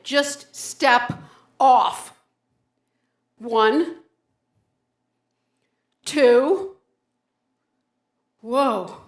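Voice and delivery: loud forced count before the jump. Acted. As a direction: push yourself out loud. Stepping off, counting loudly.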